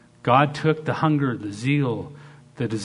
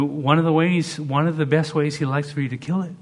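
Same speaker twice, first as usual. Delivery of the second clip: usual speaking voice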